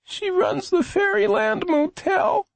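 put-on high, slow child voice; high-pitched, drawn-out, pained; weak hurt boy. A narrator's reading. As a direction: labor through the words as an injured boy. Injured boy answers policeman.

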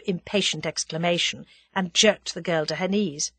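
ordinary delivery

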